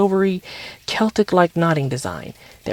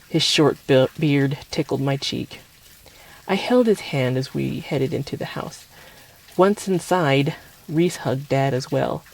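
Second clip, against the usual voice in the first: plain reading voice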